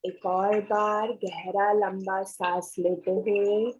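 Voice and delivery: slow meditation guide, long pauses between phrases; guided meditation. Acted. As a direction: guide the breathing slowly, drawing out the words.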